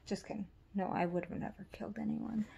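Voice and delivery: soft whispered disclaimer; a hushed little take-back; whispered aside. Real, not acted. Whispered aside after joke.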